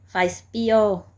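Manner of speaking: bold, loud prayer; loud plea. Retold or imitated. Daring prayer to God.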